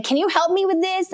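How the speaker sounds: snappy, high-pitched demand; irritated high demand to a sleeping husband; disrespectful tone. Retold or imitated. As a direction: bark it at a sleepy husband like an accusation. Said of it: "total, like, disrespectful tone of voice".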